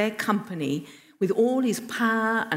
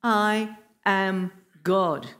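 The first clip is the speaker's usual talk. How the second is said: very slow, word-by-word declaration; really clear; much slower than usual. Retold or imitated. Jesus telling tired disciples who he is, slow and clear. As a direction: space out each word emphatically. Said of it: "really clearly saying".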